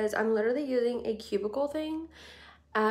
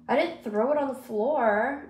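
ordinary talk, nothing marked

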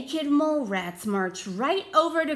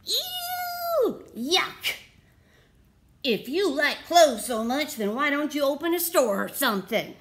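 high shriek of Ew, then a quick mocking jab; sarcastic mole rats; 4 semitones higher, 3 dB louder than usual. Mole rats sneering sarcastically at Wilbur. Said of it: "they said".

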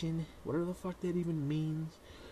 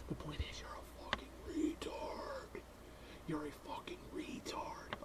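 soft, hissed insult; slow scornful whisper; mean whisper; 2 semitones lower, 12 dB quieter than usual. Acted. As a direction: whisper it with contempt.

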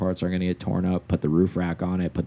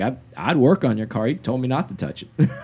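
gruff plain mechanic; 7 semitones higher, 4 dB louder than usual. Retold or imitated. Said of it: "He's like".

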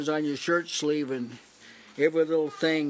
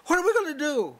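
high, loud hammed-up panicked wail; mock panic; 11 semitones higher, 3 dB louder than usual. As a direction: squeal it out like a frightened brother, overdone. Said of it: "he didn't say".

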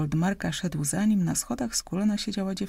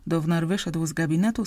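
ordinary delivery

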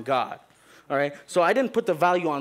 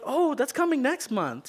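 high, slow, sugary hello to the teacher; sugary mom greeting; 10 semitones higher than usual. Retold or imitated. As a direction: greet her all sweetness, drawn way up high.

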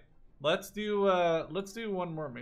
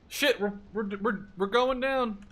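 higher, louder, slowed stammer of panic; panicked; 7 semitones higher, 4 dB louder than usual. Real, not acted. Pilot realising his plane is going down, real panic. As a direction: stumble over the words as the plane falls. Plane crashing mid-game.

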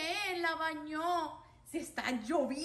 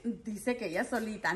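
usual speaking voice

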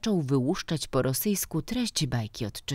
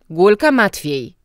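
loud, higher shout of a name; calling out; 5 semitones higher, 10 dB louder than usual. A narrator's reading. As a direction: call the pupil up loudly. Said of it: "jednak Wiechowski zawołał". (głośne wywołanie ucznia)

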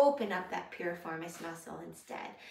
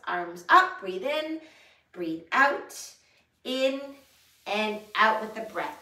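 loud cueing; 2 semitones higher, 9 dB louder than usual. Shown as a demonstration. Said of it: "coaching the movement".

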